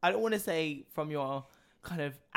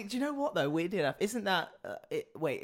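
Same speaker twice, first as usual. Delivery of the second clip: ordinary delivery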